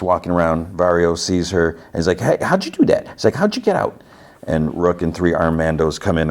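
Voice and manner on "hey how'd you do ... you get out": much higher, surprised questions; startled guard. Retold or imitated. Guard shocked to see her out, high surprise. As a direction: catch her off guard, amazed.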